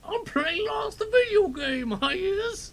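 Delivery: squeaky high, slow, loud mock British shout.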